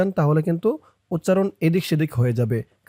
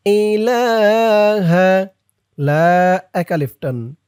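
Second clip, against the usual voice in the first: slow, higher chanted tajweed recitation; Quranic recitation; 4 semitones higher, 4 dB louder, much slower than usual. Shown as a demonstration. Teacher recites Ayatul Kursi for students to repeat. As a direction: recite with long drawn vowels.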